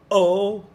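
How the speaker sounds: "o" with a hard throat grab at the start; glottal attack. Shown as a demonstration. Teacher demonstrates the glottal "o" to avoid. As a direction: clamp the throat and pop "o" out. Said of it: "that grab in the throat".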